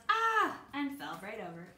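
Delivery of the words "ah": high, loud cry of fright; startled yell. A narrator's reading. Man at the door scared by Lance, yelling. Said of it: "The man yelled".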